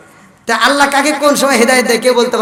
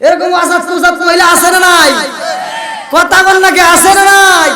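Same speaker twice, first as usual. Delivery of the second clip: raised, higher voice demanding an answer; challenging the crowd; 7 semitones higher, 5 dB louder, slower than usual. Real, not acted.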